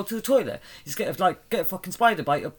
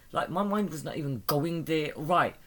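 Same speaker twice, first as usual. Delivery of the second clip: normal talking voice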